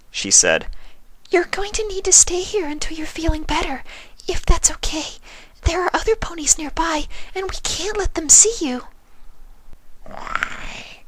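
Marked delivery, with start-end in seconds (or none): 1.3-8.9 high, breathy whisper of a timid pony; gentle, shy, barely daring to ask; Fluttershy's voice. A narrator's reading. 10.0-11.0 softer, rasping creature whisper; flat rasping buzz. A narrator's reading. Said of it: "buzzing voice was flat and rasping".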